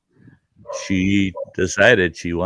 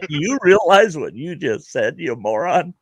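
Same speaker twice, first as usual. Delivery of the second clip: retold scorn; 8 semitones higher than usual. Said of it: "I thought".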